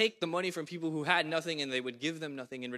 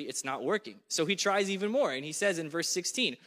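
normal talking voice

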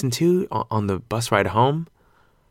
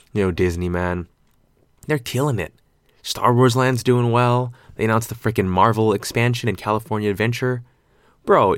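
ordinary delivery

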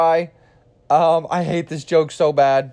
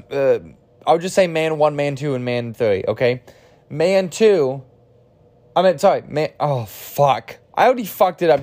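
normal talking voice

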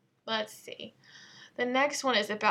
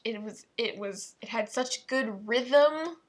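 ordinary talk

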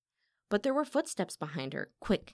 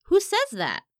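high, loud, indignant question; shocked, heated challenge; outraged demand; 13 semitones higher, 9 dB louder than usual. Acted. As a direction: demand to know the source. Falsely accused singer demands source.

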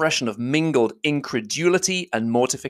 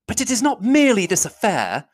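put-on higher young woman's voice, accusing; a higher heroine's voice pressing her charge; Elizabeth, indignant; 11 semitones higher, 3 dB louder than usual. Elizabeth confronting Darcy, higher and accusing.

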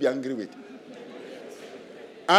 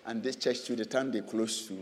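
mumbled excuses; 8 semitones lower, 10 dB quieter than usual. Retold or imitated. Preacher imitates a husband dodging church, mumbling. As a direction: lower and much softer, fumbling excuses. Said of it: "mimicking an excuse-making husband".

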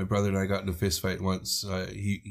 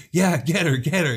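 mock cheering-on; 6 semitones higher, 4 dB louder than usual. Imitating onlookers egging on a fight.

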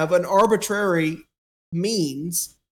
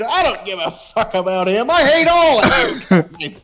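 hammed-up furious grandpa; mock angry grandpa; 3 dB louder than usual. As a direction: yell it out as the fed-up old man. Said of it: "then he's like".

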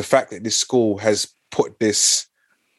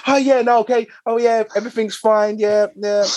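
much higher, cheery put-on telephone manner; mum's phone voice; 12 semitones higher than usual. Mum chatting to a relative on the phone, high sugary voice. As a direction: gush politely to the relative.

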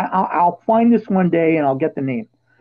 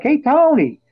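calling out from bleachers; 9 semitones higher, slower than usual. Retold or imitated. Heckling player from the bleachers.

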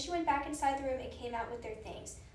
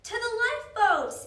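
high, loud rallying shout; alarmed shouted order; urgent yell; 10 semitones higher, 11 dB louder than usual. A narrator's reading. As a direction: yell a warning across the room. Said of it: "Annie yelled to the card players".

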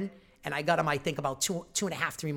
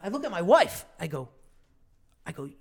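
normal talking voice

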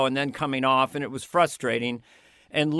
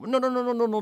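higher, rapid motorboat no's; Lorne's backpedaling; 7 semitones higher, faster than usual. Retold or imitated. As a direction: rattle off the no's quickly. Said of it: "backpedaling motorboat sound".

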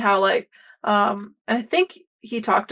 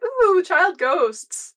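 high, slow cooing delight; higher, drawn-out mock glee; delighted party; 8 semitones higher, much slower than usual. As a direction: relish the spooky find. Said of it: "Everybody else is like".

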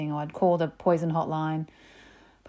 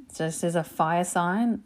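ordinary delivery

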